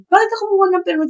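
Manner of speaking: angry husband. Retold or imitated. Husband confronting his wife about the permit, mock angry. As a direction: imitate him snapping at her. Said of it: "nagagalit siya sa akin".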